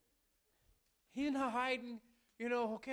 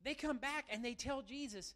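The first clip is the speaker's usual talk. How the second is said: usual speaking voice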